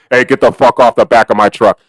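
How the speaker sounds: low, gruff mock yell at the kids; retold yell. Delivery driver yelling at kids on his truck, low mock yell. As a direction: bark it down low like a fed-up driver. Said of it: "'cause I yelled at 'em".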